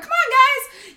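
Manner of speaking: high, loud call to the birds; high, loud herding call to the geese. Retold or imitated. Calling the geese along.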